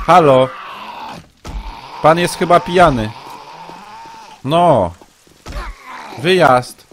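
loud, high, exasperated threats; angry scolding. Real, not acted. Streamer berating a drunk intruder, threatening police, loud and exasperated. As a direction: bark at him to calm down.

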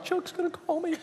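very high, soft squeak of dread; mock petrified. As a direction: squeak it out in hammed-up fear. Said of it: "I'm petrified the whole night".

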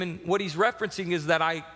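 ordinary delivery

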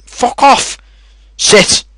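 loud, high snap at the mob; angry shout. Real, not acted. Gamer yelling at an attacker and his dog. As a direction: bark the order.

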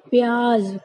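प्याज said "pyaaz", ending in z; correct z ending. Shown as a demonstration. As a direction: close प्याज on a buzzing z. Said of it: "सही बोलने का तरीका".

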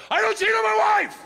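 very high, loud, fast shout; loud declaration. Pretend. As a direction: blurt it out to the whole room. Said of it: "look her in the face and go".

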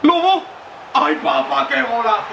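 screamed high goal call; breakneck goal commentary. Real, not acted. Goal scored in FIFA.

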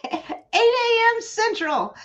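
high, slow, laughing announcement. Real, not acted. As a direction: sing out the time through a laugh. Announcing video time, laughing.

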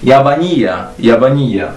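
jabanija ending in a clear ija; Arabic pronunciation. Shown as a demonstration. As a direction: model jabanija for learners. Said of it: "ta marbuta, daje nam dźwięk ija". (arabska wymowa jabanija z końcówką ija)

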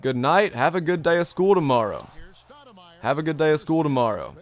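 ordinary talk, nothing marked

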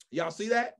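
ordinary delivery

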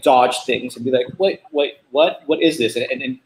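ordinary talk, nothing marked

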